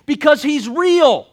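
slow, high, loud proclamation; preaching climax. Real, not acted. Preacher proclaims to the congregation, loud and slow. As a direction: drive it home full force.

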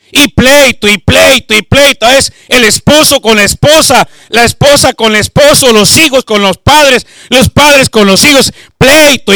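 loud, fervent sermon delivery; preaching at full force. Acted. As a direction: preach it out to the congregation.

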